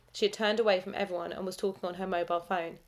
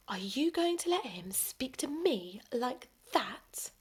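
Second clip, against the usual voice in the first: furious hushed whisper; a girlfriend's whispered, fuming demand; 4 semitones higher, 3 dB quieter, slower than usual. A narrator's reading. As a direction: hiss it, slow and angry under the breath.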